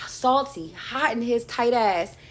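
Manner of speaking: loud, high sassy call; sassy shout. Real, not acted. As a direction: belt it out with sass. Sassy outburst after whisper.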